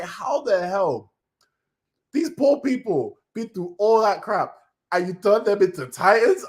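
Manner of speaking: outraged disbelief. Retold or imitated. Outrage at Eren.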